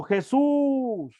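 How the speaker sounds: high, long-held calling cry. Retold or imitated. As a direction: imitate lepers calling from a distance. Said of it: "alzaron la voz diciendo".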